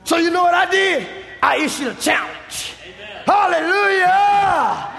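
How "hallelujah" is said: preaching shout. Real, not acted. Preacher shouting hallelujah to the congregation. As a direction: cry it out to the church. Preacher exclaims mid-sermon.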